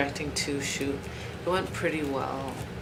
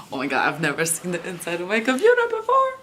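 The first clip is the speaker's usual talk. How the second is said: excited; 3 semitones higher, 8 dB louder, faster than usual. Real, not acted. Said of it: "You were excited".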